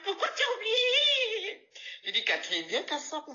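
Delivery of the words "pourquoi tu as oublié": put-on high, slow wailing old woman; high slow wailing plea; crying old lady. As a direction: wail it like a weeping old lady.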